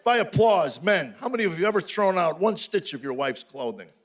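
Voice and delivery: ordinary delivery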